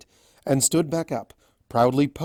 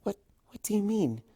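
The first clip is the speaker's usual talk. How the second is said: curious; 8 semitones higher, 4 dB quieter than usual. A narrator's reading. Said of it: "big, curious eyes".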